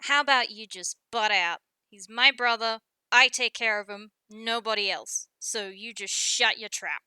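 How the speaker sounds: loud, firm, protective telling-off; angry rebuff. Acted. Guppy tells Specs to butt out about his brother, angry. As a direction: push each phrase out hard, pitch held level.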